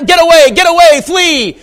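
high, loud cry of alarm; urgent shouted warning. Acted. Warning Samson to escape Delilah, urgent shout. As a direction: yell it as an emergency warning.

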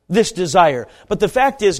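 ordinary delivery

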